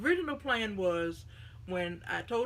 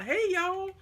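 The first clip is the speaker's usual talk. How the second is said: loud, high Southern drawl on "Hey, y'all"; hammed-up Southern accent calling "Hey, y'all"; 10 semitones higher, 6 dB louder than usual. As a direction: throw out a big Southern greeting. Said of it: "my southern accent".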